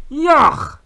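loud, high yell of disgust; disgusted cry. A narrator's reading. Giant spits out his drink, crying out in disgust. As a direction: cry out as if spitting out a foul drink. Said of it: "riep hij en sproeide de inhoud van zijn mond".

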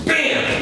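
very high shouted bam; mock bang. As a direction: pop out the noise like a sound effect. Director voices the noise cue.

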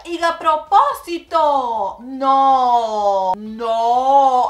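loud, slow, outraged; indignant outburst. Real, not acted. Reacting to the aunt's trick, loud indignant protest. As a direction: call out the aunt's bad move loudly.

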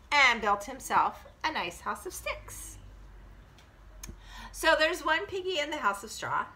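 ordinary delivery, nothing marked

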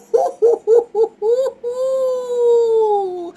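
high loud hoots; imitated hooting. Hooting about the storm. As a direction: hoot like the storm. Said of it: "I'm like".

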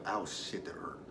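hushed swear after a sudden sting of pain; pained whisper. Real, not acted. Hurting herself mid-story, she whispers a swear. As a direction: wince and curse under your breath.

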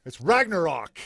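Ragnarok proclaimed with a hint of Viking chieftain; lightly voiced chieftain's proclamation of Ragnarok. Shown as a demonstration. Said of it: "say it like a Viking chieftain".

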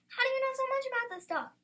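high-pitched, puzzled demand; incredulous challenge. A narrator's reading. Indignant challenge in quarrel over dog.

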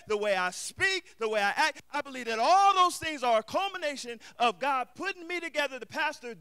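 loud, rising sermon delivery, hammering each phrase; preaching at full force. Acted. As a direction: preach it to the back pew, building.

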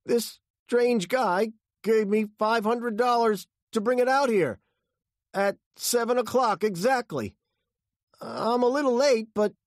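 very high, loud, frightened voice; anxious high-pitched gabbled excuse; panicked explaining. A narrator's reading. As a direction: plead your innocence at gunpoint. Frightened driver explains at gunpoint.

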